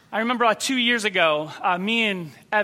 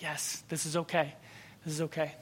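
calm reassurance; 4 semitones lower, 12 dB quieter than usual. Retold or imitated. Evan answering the frightened woman.

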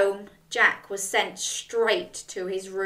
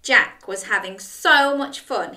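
usual speaking voice

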